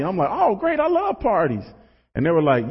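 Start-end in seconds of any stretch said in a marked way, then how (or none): 0.3-1.6 high, naively delighted reply; mock eager. Speaker replays his high school self hearing of a party, eager. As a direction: play the keen kid, pitched well up.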